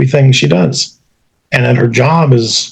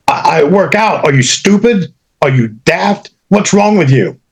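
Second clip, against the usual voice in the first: irritated outburst; 6 semitones higher than usual. Retold or imitated. Woman annoyed at repeated question.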